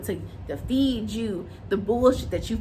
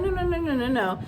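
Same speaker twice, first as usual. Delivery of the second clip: imitating the mom; 5 semitones higher than usual. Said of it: "She does this, like".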